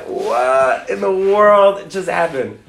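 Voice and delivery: slow, drawn-out dumbfounded question; acted bewilderment, stretching the words; stunned disbelief. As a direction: say it slowly, as if left speechless. Acts out friend's stunned reaction.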